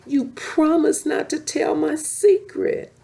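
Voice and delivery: high, loud angry accusation. Acted. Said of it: "she told him angrily".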